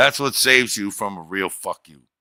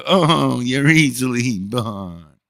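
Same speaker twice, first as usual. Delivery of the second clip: slow, higher mock of her voice, the Oh up high; 5 semitones higher, slower than usual. Retelling the jab at his daughter in a slow, high mocking voice. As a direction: stretch it out to needle her. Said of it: "And in that voice, ... mock their voice".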